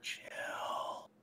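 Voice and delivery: soft, unvoiced whisper. Felix telepathically tells Casey to chill, whisper. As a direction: breathe the word out in a hushed whisper. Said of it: "it's coming in a whisper".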